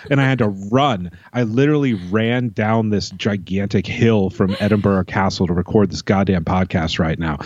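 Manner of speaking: normal talking voice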